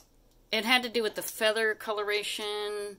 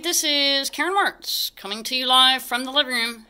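announcer greeting; 7 semitones higher, 7 dB louder than usual. Real, not acted. Live video greeting to viewers.

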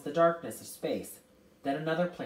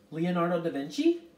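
higher, questioning suggestion; tentative; 4 semitones higher, 7 dB louder than usual. A narrator's reading. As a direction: offer the name as a question. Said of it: "Calvin suggested tentatively".

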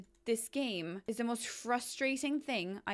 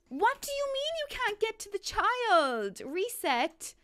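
exasperated shriek; 11 semitones higher, 4 dB louder than usual. Real, not acted. Exasperated at glitching sim.

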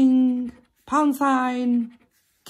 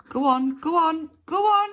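rising eager urging; 7 semitones higher than usual. Real, not acted. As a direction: go on, climbing higher each time.

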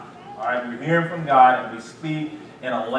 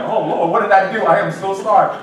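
flustered apology; 3 semitones higher, 7 dB louder than usual. Retold or imitated. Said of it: "and you're just like".

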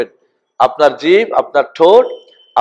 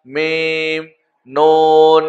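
drawn-out, level-pitched letter names; alphabet recitation; much slower than usual. Shown as a demonstration. Teacher leading learners through the Arabic alphabet, measured recitation. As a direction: hold each Arabic letter name on a steady note.

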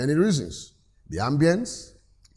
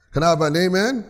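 raised, rousing call for an amen; livelier repeat call; 2 semitones higher, faster than usual. Real, not acted. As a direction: ask with more push, lifting "amen" at the end. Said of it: "you didn't say that so loud".

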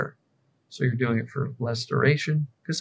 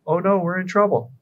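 high, louder mock panic; 7 semitones higher, 4 dB louder than usual. Fearful thought panicking, mock alarm, high. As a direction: cry out like a frightened thought. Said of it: "fearful thoughts going".

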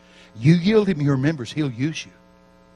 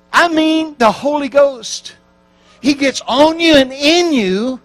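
usual speaking voice

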